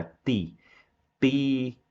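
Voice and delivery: "Teacher" split in two, "tee... cher"; pronúncia correta. Shown as a demonstration. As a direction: model a pure T, pausing inside "Teacher". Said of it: "Essa é a forma correta". ("Teacher" separado, "tí... tcher", com T puro)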